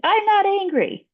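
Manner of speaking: put-on high, slow child protest; whiny little kid denying it. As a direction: pipe up like a sulky kid insisting. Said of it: "the kid's like".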